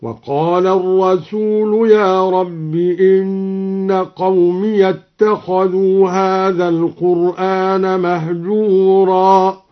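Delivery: slow chant, قومي with its ya voiced open. Shown as a demonstration. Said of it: "وفتح الياء من قوله تعالى". (فتح الياء في قومي)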